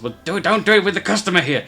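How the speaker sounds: high goblin reproach; scolding goblin. Acted. Goblin scolds colleagues in front of customer, high-pitched.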